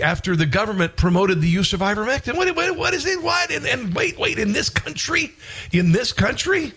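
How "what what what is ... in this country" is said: high, rising disbelief; can't believe it, voice jumping up; incredulous. Real, not acted. Host reacting in disbelief to ivermectin news.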